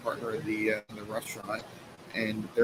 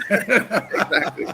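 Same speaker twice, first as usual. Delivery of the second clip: high, loud, drawn out through laughter; laughing agreement; 10 semitones higher, 7 dB louder, much slower than usual. Real, not acted. Guest agrees with host's joke about looking smart, laughing.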